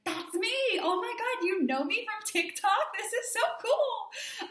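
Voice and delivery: squealing high, delighted fangirl; slow, high, giggly excitement; geeking out. Retold or imitated. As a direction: gush like a thrilled fan who just got recognised. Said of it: "I was, like, geeking out".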